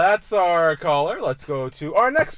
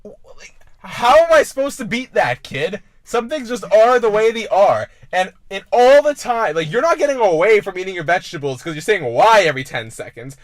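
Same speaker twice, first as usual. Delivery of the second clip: put-on loud, high scolding of a kid; mock lecturing shout; 6 semitones higher, 8 dB louder than usual. Podcast host scolding a kid, loud and high. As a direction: harangue the kid about vegetables. Comedic rant at a child.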